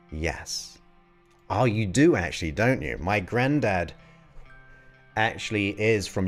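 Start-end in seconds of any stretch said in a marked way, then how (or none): none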